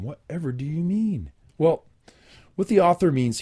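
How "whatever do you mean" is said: mock innocence. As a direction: ask it coyly, as if you had no idea. Feigns innocence about the euphemism.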